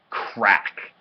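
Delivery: loud, explosive sound word; sudden crack. A narrator's reading. Ball smashing into his nose, explosive. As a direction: burst out with it like an impact.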